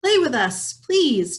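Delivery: taunting mice. Acted. Mice calling to Tweezle.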